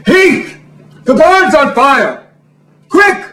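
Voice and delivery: high, urgent movie-heroine cry; stretched, high-pitched dramatic call for help; Barbara Stanwyck. Acted. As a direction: raise the alarm in hammed-up melodrama. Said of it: "doing Barbara Stanwyck impersonations".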